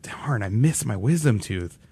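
soft, slow mock regret; mildly upset. Patient missing a pulled wisdom tooth, mildly upset. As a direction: grumble softly over a small loss. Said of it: "I was kind of upset".

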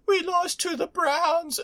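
imitating a weepy loser, laughing; mock bawling. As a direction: blubber it like the crying quarterback. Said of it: "bawling on the sidelines".

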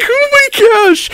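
very high squeal; excited squeal. Real, not acted.

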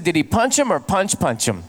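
mock the doubled "punch" with a falling tag; imitating a man doubling "punch"; double word stress. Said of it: "the other person said".

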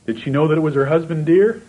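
rising to a high, loud cry; swelling preacher's cry on dear; preaching climax. Real, not acted. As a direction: lift the question up and call it out to the congregation.

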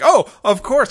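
loud, high mock epiphany; exaggerated fake enlightenment; sarcastic realization. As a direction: feign a sudden brilliant insight. Sarcastic mock reply to shouted order.

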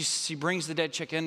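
ordinary delivery

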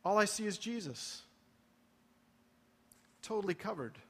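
ordinary talk, nothing marked

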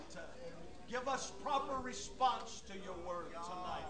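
hushed, earnest plea to God; prayer. Real, not acted. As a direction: pray as if away from the microphone. Leads congregation in prayer.

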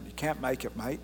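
lightly voiced Australian accent, "mate" in Australian; a casual Australian accent on "you can't make it, mate". Shown as a demonstration. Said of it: "In the Australian".

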